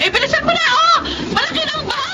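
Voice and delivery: high, frantic hurry in a flood; panicked urging. Acted. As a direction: urge him on in panic as the water rises.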